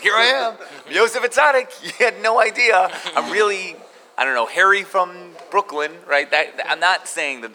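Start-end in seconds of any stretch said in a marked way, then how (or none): 0.0-5.9 higher, louder triumphant unmasking voice; mock grand reveal. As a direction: announce yourself like a hidden hero unmasked, laughing. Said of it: "pull off the mask and be like".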